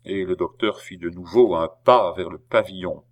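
usual speaking voice

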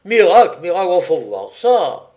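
high, loud cries of astonishment; hollering. A narrator's reading. Jean marvels at a capitalist Magouline, hollering. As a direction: holler each word out, punching the first. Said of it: "hurla Jean Travers".

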